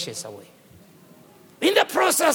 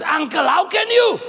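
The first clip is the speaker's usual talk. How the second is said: indignant cry; 6 semitones higher than usual. Acted. Jacob confronts uncle over wife.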